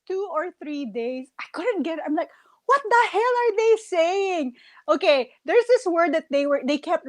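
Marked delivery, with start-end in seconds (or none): 2.7-4.5 high-pitched exasperated disbelief; comic, incredulous outburst; mock bafflement. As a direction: act totally lost by what you hear. Retelling her baffled reaction to accent.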